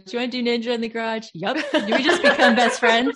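hammed-up movie-buddy eagerness; Step Brothers quote. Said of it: "Step Brothers".

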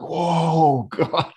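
high, mock-stunned exclamation; shocked reaction. Retelling Jeff's shock at pictures.

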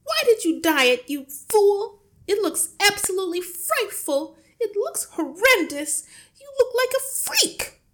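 high, piercing scolding tirade; appalled wife, shrill and very loud; horrified shriek. A narrator's reading. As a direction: screech your disgust at your husband. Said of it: "shrieked the mother".

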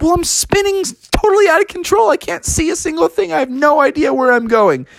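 high, frantic complaint; acted out-of-control panic; flustered Froggy. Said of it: "And Froggy was like".

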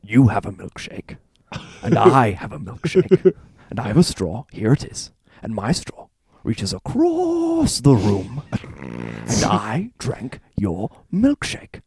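slow, drawn-out menacing monologue voice; Daniel Plainview impression. Podcaster doing Plainview's milkshake monologue, slow and menacing. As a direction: savour each word like a gloating oilman. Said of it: "Should we all do our Daniel Plainview impressions now?".

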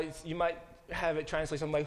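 ordinary talk

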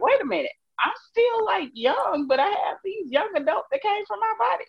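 high, disbelieving quoted voice; incredulous realization. Retold or imitated. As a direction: voice her own disbelief. Quoting her own realization.

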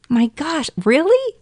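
aghast, unable to believe it; stunned disbelief. Retold or imitated. Retelling the guest yelling about his reservation, in stunned disbelief. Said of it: "I was just absolutely blown away".

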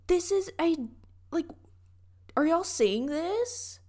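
high-pitched mock amazement; high, incredulous gushing over an exhibit; annoying museum enthusiast. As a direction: imitate yourself pestering friends in wonder. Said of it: "I'm annoying at museums".

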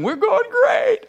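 very high, squeaky cheer; hammed-up thrill at how well it goes; mock giddy confidence. Acts confident start before panic.

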